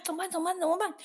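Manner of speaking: rising high panicky "怎么办" repeated. Retold or imitated. Narrator retelling her panic at the bus stop, high and anxious. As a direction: voice climbing in mounting panic. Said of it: "越想越紧张".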